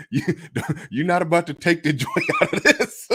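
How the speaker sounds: can't hold back real laughter while talking; laughing. Real, not acted. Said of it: "I'm just laughing at this shit".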